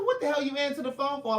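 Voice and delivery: high, indignant retort; mock exasperated yell. To friend answering at a funeral, mock exasperated. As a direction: scold him for picking up. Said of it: "I said".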